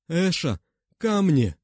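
loud strained cry; attempted shout. A narrator's reading. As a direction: try to shout it. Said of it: "попыталась закричать Арма".

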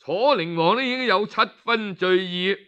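ordinary delivery

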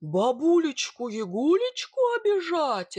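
bogatyr's angry bellow. Acted. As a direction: roar it out in outrage. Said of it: "рявкнул богатырь".

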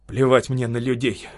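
usual speaking voice

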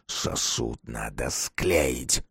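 very low, soft, slow monster; ominous low slow intoning; alien crowd voice. A narrator's reading. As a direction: drop deep and draw it out. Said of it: "чужих и настоящих".

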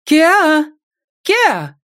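very high, loud calling. Acted. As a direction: call after her into the yard. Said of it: "принялась звать". (зовёт громко)

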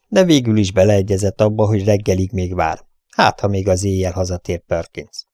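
ordinary delivery

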